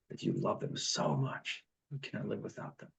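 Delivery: half-whispered, fading to almost nothing; hushed repeat. Shown as a demonstration. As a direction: drop to a soft whisper to drive it home.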